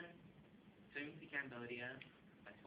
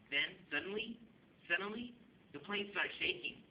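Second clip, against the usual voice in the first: higher, louder, suspenseful build; mounting alarm; 8 semitones higher, 7 dB louder than usual. Acted. Actor telling of a plane shaking, mounting alarm. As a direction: build the sudden alarm on a raised pitch.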